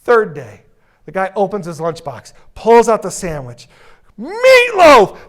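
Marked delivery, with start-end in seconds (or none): 4.2-5.2 very high, loud rage; furious shout. Retold or imitated. Worker on the third day, in a furious screaming rage. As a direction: scream it in fury.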